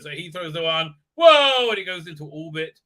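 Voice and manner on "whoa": loud, high drawn-out "whoa"; mock throwing yell. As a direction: holler a hammed-up whoa for a huge throw. Imitating mighty throw into orbit.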